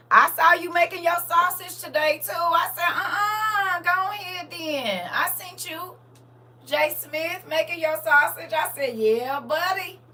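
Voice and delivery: high, loud excited hollering, words stretched out. Real, not acted. Cheering on someone making sausage, excited hollering.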